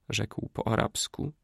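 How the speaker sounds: ordinary delivery, nothing marked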